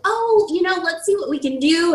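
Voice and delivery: high, sugary noncommittal; mock polite stalling. Teen mimicking principals' polite stalling, high and sugary. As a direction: sound nice while promising nothing. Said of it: "they were like".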